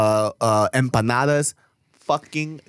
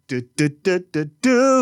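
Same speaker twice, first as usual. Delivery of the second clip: stuttered hype intro; 6 semitones higher, slower than usual. Acted. Hyping the show name.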